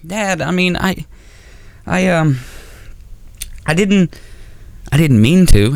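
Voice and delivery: high, halting mock stammer; imitating scared kid. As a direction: stammer like a caught child. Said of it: "And I'm like".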